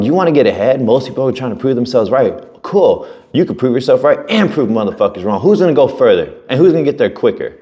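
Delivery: insistent, high-pitched retold motivation; fired-up pep talk. Mentor urging a client to get ahead, fired up. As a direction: push each point like a worked-up coach.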